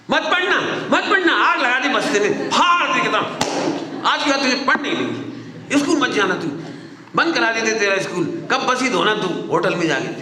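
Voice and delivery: hammed-up furious mother; mock yelling mother. Mother scolds the child who won't study, mock yelling.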